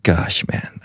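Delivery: whispered, weary grumble; whispered sigh. Retold or imitated. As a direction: breathe it out under your breath. Sulking in the cart.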